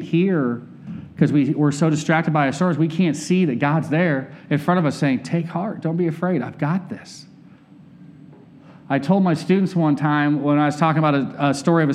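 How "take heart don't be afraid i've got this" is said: much softer, tender reassurance; almost hushed comfort, as God speaking; gentle soft voice of comfort; reassuring God. Acted. God's reassuring words to the listener.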